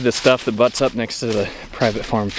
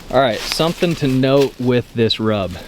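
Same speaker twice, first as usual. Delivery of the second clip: ordinary talk, nothing marked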